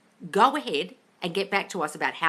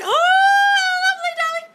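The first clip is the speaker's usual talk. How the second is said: very high, loud, drawn-out whoop of delight; delighted squeal; 11 dB louder, slower than usual. Real, not acted. Coach celebrating a listener's coffee invitation, delighted squeal. Cheers a listener's good news.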